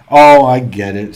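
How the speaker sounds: loud burst of realisation; oh, I get it now, said loud; sudden realisation. Real, not acted. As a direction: blurt it out as the joke lands. Suddenly grasps a viewer's joke.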